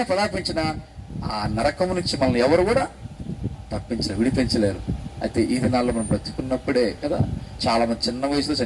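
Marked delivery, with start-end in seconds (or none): none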